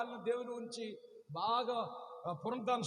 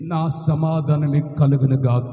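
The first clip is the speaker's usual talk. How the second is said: solemn blessing; 7 semitones lower, 15 dB louder, slower than usual. Acted. Jesus blesses the disciples.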